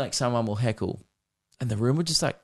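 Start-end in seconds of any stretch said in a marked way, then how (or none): none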